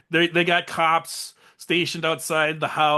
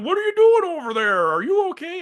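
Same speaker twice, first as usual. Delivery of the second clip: much higher, whiny mock killer voice; creepy boyfriend; 5 dB louder than usual. Boyfriend calling to the hiding girl, high and whiny. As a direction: whine it like a creepy stalker. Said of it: "He's like".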